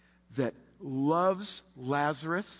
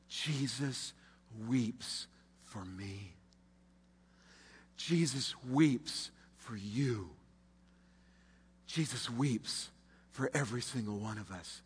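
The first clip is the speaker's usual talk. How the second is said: tender; 4 semitones lower, 6 dB quieter, slower than usual. Acted. Tender climax to congregation.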